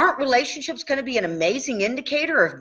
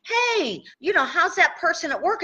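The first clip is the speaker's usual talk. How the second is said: much higher, sing-song friendly question; bright cheery check-in; 8 semitones higher than usual. Retold or imitated. Coach asking a client about a coworker, high and cheery. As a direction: chirp it like a sunny friend asking. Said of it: "I will say".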